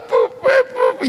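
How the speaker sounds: put-on very high, squealed child's wail; hammed-up baby cry in falsetto; imitating crying toddler. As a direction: mimic a little girl crying, way up high. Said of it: "nadie sigue llorando".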